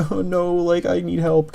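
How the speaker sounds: high, whiny mock damsel; hammed-up high plea for help; mock helpless character. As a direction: squeak it up like a helpless girl. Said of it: "a character who's not just like".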